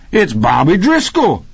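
high-pitched cry of recognition; excited shout of discovery. A narrator's reading. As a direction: call it out high, thrilled to spot an old friend. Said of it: "Rocky cried".

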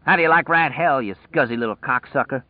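mocking yell at the rat; taunting cry. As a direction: taunt the dead rat, bursting up then settling.

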